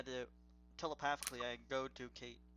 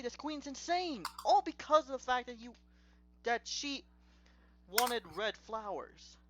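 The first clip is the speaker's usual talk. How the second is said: incredulous outburst; 7 semitones higher, 4 dB louder than usual. Real, not acted. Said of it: "who isn't insane".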